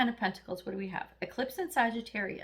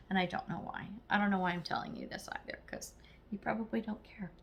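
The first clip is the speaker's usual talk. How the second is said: whispered aside; 3 semitones lower, 7 dB quieter than usual. Real, not acted. Confessional aside to viewers.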